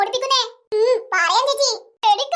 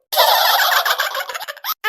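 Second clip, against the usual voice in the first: gleeful cartoon laugh; 7 semitones higher, 3 dB louder than usual. Kids gloating over their plan.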